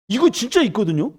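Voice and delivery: loud, high-pitched proclamation; full-force preaching. Real, not acted. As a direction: drive the good news home.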